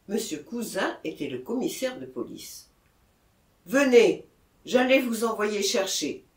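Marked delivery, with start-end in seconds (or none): 3.7-6.2 calling over. A narrator's reading. Mayor calls them over.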